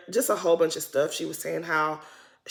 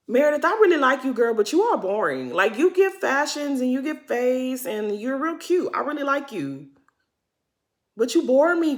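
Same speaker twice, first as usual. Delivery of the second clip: mock address to Meredith; 5 semitones higher than usual. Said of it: "addressing Meredith directly".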